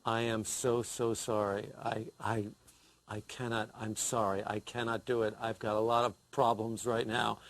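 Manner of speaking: mock-mournful, low and hushed at first; funereal voice. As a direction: put on a solemn, grief-laden apology. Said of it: "I put on my funereal voice".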